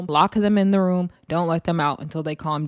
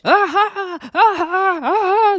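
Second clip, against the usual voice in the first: high, loud wordless wailing in imitation; mock yelling; 12 semitones higher, 6 dB louder, slower than usual. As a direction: mimic two people yelling over each other. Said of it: "they're, like, yelling out of the top of their lungs".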